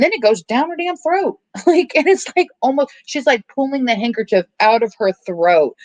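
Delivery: high, squeamish storytelling; grossed-out squeal. Real, not acted. Telling viewers about the handkerchief down her throat, grossed-out squeal. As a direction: let disgust push the voice way up. Grossed out retelling the gag scene.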